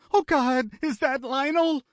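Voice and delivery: high, breaking dread; a man finding his dead brother; horrified. A narrator's reading. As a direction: realise it with horror. Finds brother's body in dark.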